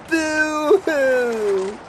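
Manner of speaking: high, mocking cry of boo-hoo; mock wailing. The Grinch mocks the crying Whos, shouting high. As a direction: make fun of the Whos crying. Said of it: "the Who's down in Whoville will all cry".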